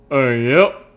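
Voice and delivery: loud drawn-out agreement, rising at the end; Calamity's folksy voice. A narrator's reading. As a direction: belt out a folksy yep. Calamity's reply in narrated fiction.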